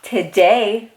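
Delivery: ordinary delivery, nothing marked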